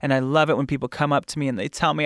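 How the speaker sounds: normal talking voice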